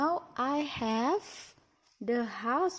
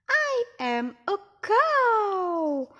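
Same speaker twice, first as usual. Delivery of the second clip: cow voice; 7 semitones higher, 9 dB louder, slower than usual. Pretend. Speaking as the cow toy.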